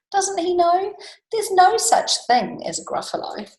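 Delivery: high, loud amused scorn; lifted, sing-song mockery; gloating mouse. Mouse gloating over fooled snake.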